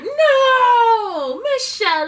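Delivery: high, loud mock outcry of no; judge cutting in. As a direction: yell it like a judge shutting Michelle down. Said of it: "Judge was like".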